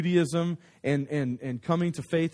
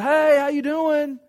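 high, loud, drawn-out greeting; exaggerated neighborly holler; friendly call-out; 11 semitones higher, 5 dB louder, slower than usual. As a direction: call cheerily across a driveway. Waving, calling out to the dad.

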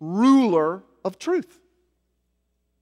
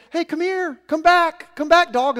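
calling a dog; 8 semitones higher than usual. Retold or imitated. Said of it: "I was saying".

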